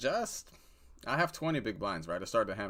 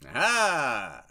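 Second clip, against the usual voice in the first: triumphant aha; 5 semitones higher, 7 dB louder than usual. Real, not acted. Triumphant poker reaction.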